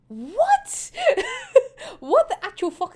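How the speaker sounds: high-pitched, incredulous "what?"; hammed-up bewilderment; baffled disbelief. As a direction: squeak it out in mock shock. Acting Sigma's bewildered reaction.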